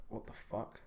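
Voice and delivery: mock disbelief. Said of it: "I'd be a bit like".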